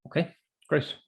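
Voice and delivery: usual speaking voice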